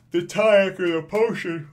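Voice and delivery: talking right through a yawn. Real, not acted. Reviewer overtaken by a midday yawn, real yawning speech. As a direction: let a big yawn swallow the words. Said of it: "middle of the day and I'm yawning".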